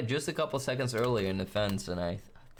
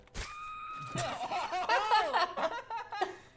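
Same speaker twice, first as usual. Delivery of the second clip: 1 shrill evil laugh. Acted. Evil laugh after threat.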